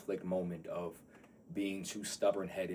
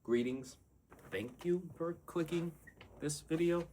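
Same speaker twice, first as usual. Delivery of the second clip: higher, put-on formal greeting; announcer voice; 9 semitones higher than usual. Shown as a demonstration. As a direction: try on a stiff host voice. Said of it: "That don't, greetings".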